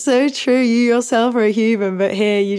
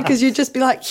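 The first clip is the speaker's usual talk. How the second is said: ordinary delivery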